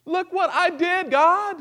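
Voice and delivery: high, slow mock bragging; hammed-up proud boast to God; boastful demand. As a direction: crow at God about your deeds. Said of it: "had the audacity to stand before God and say".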